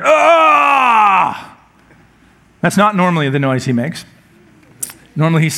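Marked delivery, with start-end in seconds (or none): 0.0-1.6 imitating a sudden sore-muscle yell, pitched high; mock son crying out in pain from his bedroom; son's pained yell. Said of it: "I heard".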